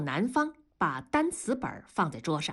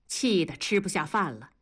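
ordinary delivery, nothing marked

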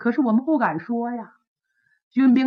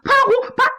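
angry shout; 9 semitones higher than usual. Acted. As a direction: yell his name up at the wall in rage. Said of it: "七郎火了".

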